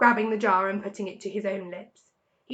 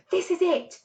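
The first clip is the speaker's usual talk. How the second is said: cried; 8 semitones higher than usual. A narrator's reading. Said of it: "cried Mister Fox".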